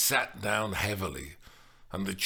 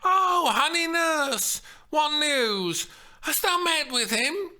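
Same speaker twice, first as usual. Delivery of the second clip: beseeching Juliet; 6 dB louder than usual. Acted. Steph as Juliet begging the nurse, high and pleading. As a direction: beg the nurse for news. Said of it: "clasped her hands together beseechingly".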